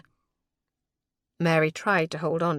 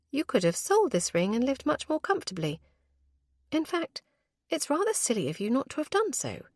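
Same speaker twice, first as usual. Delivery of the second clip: mild admonishing; 7 semitones higher than usual. A narrator's reading. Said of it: "she admonished".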